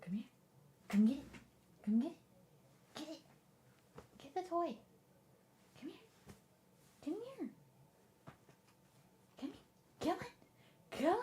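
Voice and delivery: high, quiet pet-calling voice; short, soft, high-pitched coaxing calls; coaxing the dog. Real, not acted. Streamer luring her dog with a toy, high and coaxing.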